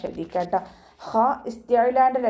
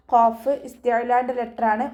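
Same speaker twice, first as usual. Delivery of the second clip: ordinary talk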